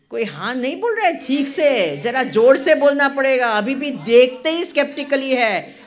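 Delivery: loud fast prodding; loud urging. Real, not acted. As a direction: demand a proper yes from the hall. Urging audience to answer louder.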